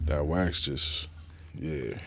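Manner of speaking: slow, drowsy and mumbled; words dragging out, barely there; stoner. Real, not acted. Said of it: "I'm high as fuck right now".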